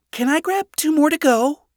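put-on high, girlish character voice; daughter's casual request. A narrator's reading. Daughter asks father for pancakes.

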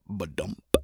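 low, soft heartbeat thump. A narrator's reading. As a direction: drop low and soft like a pulse. Heartbeat sound in narration.

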